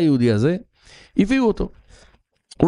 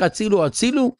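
mild mock cry, pitched higher; retold call for help; 6 semitones higher than usual. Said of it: "צועק". (קריאת הצלה מתונה, בחיקוי)

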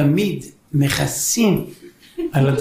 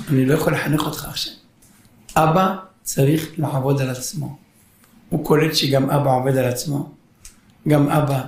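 usual speaking voice

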